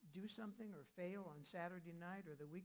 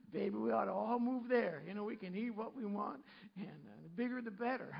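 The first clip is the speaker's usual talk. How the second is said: much louder, teasing call to pack up; loud mock enthusiasm to his baby; excited joking shout; 3 semitones higher, 12 dB louder than usual. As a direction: shout it like a gleeful invitation. Jokingly urging move to Fiji.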